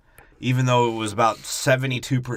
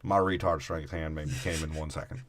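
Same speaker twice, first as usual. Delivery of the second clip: put-on Bama boy; 6 semitones lower, 9 dB quieter than usual. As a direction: play a subdued Southern country boy. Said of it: "Bama boy".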